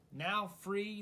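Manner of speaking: usual speaking voice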